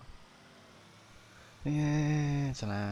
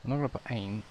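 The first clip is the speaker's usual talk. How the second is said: normal talking voice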